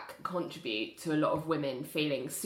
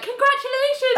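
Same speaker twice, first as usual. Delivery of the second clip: very high, loud congratulations; awkward fake cheer; 9 dB louder than usual. Host mimics Ali congratulating the couple, awkwardly over the top. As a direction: force out a bright congratulations. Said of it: "this is so awkward, she's like".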